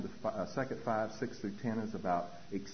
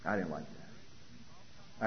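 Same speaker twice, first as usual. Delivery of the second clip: muttered whisper; 4 semitones lower, 9 dB quieter, much slower than usual. Real, not acted. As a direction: whisper "I didn't like that" very slowly, under the breath. Stung by sponsor's remark.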